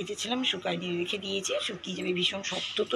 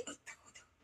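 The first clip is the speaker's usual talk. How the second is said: whisper; 25 dB quieter, faster than usual. Real, not acted. Mother secretly pointing out the hiding toddler, whispering. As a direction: breathe it out, no voice at all.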